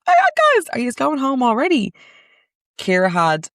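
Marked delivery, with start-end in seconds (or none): 0.1-1.9 high, loud, surprised greeting; pitched way up in mock surprise. Surprised to see friends heading home, high and loud.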